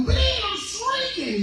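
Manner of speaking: very high, slow tiny voice; the belly whimpering as it shrinks; tiny stomach squeak; shrinking stomach. Acted. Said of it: "stomach voiced".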